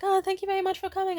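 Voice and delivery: high, fast mock-polite host. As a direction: chirp the welcome like staff. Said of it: "if someone's like".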